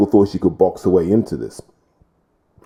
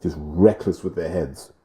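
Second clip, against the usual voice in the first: normal talking voice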